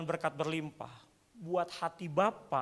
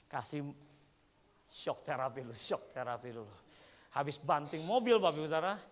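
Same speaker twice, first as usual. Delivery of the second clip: ordinary delivery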